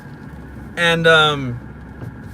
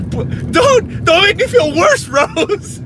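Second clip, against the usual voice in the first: high, loud playful whine; mock protest; 11 semitones higher, 4 dB louder, slower than usual. Speaker telling Rose off mid-rant, mock upset.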